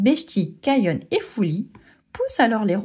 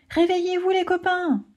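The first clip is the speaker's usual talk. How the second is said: mock shout; 10 semitones higher than usual. Lion cub waking his napping friends, a pitched-up mock call. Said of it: "crie le lionceau".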